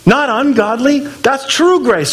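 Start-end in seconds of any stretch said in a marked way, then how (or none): none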